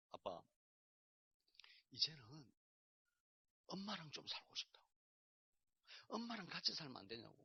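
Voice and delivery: quietly voicing his young son's plea; a hushed, small child asking for mom; soft child's plea. Young son asking his dad to live with mom, softly voiced.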